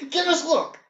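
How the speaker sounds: put-on high kid voice. Kids demanding from the leprechaun.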